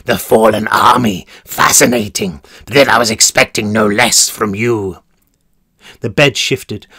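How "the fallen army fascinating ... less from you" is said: low, sickly sweet gloating; gleeful warden. Acted. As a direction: relish every word with glee. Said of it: "the glee in his voice like rancid honey".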